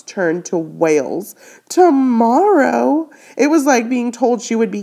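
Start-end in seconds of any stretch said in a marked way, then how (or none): 1.7-3.1 Tomorrow? drawn out slow, rising high and loud; shocked echo. Acted. Winnie hears she will be kept away, asking Tomorrow? in shock. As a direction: throw Tomorrow? back in dismay, rising high.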